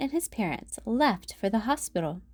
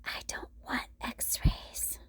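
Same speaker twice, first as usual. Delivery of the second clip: slow, much softer whisper, scared; 9 dB quieter, slower than usual. A narrator's reading. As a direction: breathe it out slowly like a frightened child. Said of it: "whispered Franklin".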